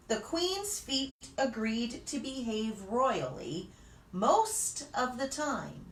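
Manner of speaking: ordinary delivery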